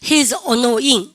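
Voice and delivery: each word drawn out for students to repeat; slow, careful classroom demo sentence; slow model reading. Shown as a demonstration. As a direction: read it very slowly and clearly, like a teacher's model. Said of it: "시작".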